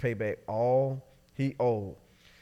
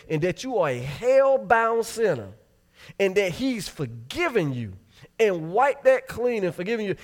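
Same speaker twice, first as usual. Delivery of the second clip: higher, rising preaching build; fervent preaching; 6 semitones higher, 4 dB louder than usual. Real, not acted. Preacher confronting the church about keeping a ledger, fervent and rising. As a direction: drive it home to the congregation.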